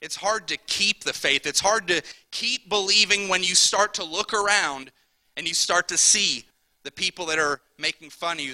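ordinary talk

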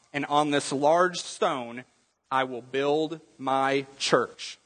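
ordinary talk

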